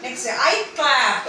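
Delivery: very slow, stretched teaching model; slow model phrase. Shown as a demonstration. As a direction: spell it out slowly for little ones. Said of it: "teacher models phrase slowly". (കുട്ടികൾക്കായി വളരെ പതുക്കെ)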